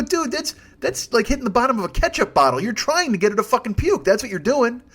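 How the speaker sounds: high, loud, disbelieving rant; exasperated outburst. Retold or imitated. Driver to the friend slapping her, high loud exasperation. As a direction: blurt it out in total exasperation. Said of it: "I wanna go".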